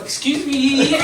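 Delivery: the big guy mock-polite to the driver; meek politeness. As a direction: shrink from a fighter into a polite gent. Huge guy politely backs down.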